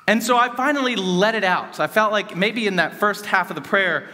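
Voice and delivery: ordinary delivery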